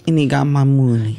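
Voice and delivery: and he got my money in a thicker Mexican accent. Shown as a demonstration. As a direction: lay the Mexican accent on heavier. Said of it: "You gotta do that more Mexican sounding".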